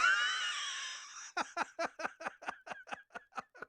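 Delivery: high laugh on the edge of crying; despairing laugh. Real, not acted. Laughs after dying in game.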